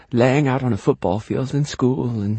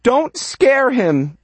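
high, loud, drawn-out scream; frantic high-pitched mother; 13 semitones higher, 5 dB louder, much slower than usual. Acted. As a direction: scream at your husband to stop. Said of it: "my mother starts screaming".

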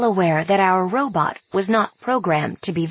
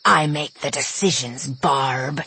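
snapping; 5 semitones lower than usual. Acted. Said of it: "snapped the wolf leader".

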